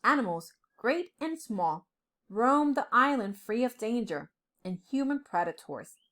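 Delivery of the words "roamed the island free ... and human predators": ordinary delivery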